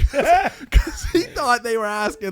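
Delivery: talking through laughter, high and slow; can barely get it out for laughing. Real, not acted. Telling friends the Subway story, laughing.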